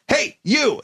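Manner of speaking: high sharp call; retold yell to get his attention. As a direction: bark it across the room. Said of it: "I yelled at him".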